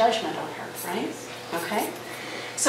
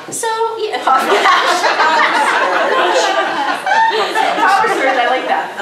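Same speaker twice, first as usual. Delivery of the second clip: much higher, louder, laughing through the words; 10 semitones higher, 5 dB louder, much slower than usual. Real, not acted. Trainer laughs at volunteer's sweaty-hand joke, laughing through words.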